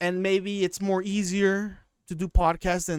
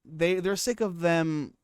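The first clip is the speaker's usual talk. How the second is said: usual speaking voice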